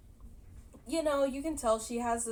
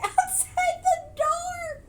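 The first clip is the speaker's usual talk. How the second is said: high, drawn-out laughing squeal; laughing while talking; 7 dB louder, much slower than usual. Real, not acted. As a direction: crack up halfway through the phrase. Breaks into laughter telling scene.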